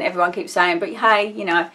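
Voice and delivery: ordinary delivery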